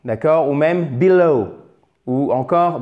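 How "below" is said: below as bilo, no glide on the final O; faulty demo of below, undiphthongised; mispronounced below. Shown as a demonstration. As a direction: show the faulty below with a single vowel ending. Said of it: "c'est important de ne pas dire".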